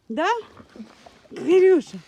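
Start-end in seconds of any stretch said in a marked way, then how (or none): 1.3-2.0 loud, high call to the dog. Real, not acted. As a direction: call her name across the yard. Calling the dog.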